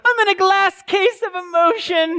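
comic melodramatic whine, high and tight; overwrought movie quote. Retold or imitated. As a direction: play it like a man falling apart over nothing. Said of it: "where he says, right, he's real emotional".

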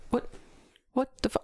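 high, squeaky disbelief; a retold what-the-hell; baffled. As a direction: pitch it way up in confusion. Baffled at the driver leaving.

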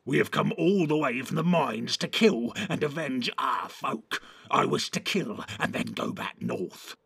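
lightly voiced aggrieved orc; vengeful orc. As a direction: protest as an orc set on killing.

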